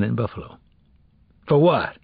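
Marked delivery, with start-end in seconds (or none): 1.5-2.0 short, higher-pitched demand; impatient interrogator; snapped question. A narrator's reading. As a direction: snap it at the suspect. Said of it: "Del Rey snapped".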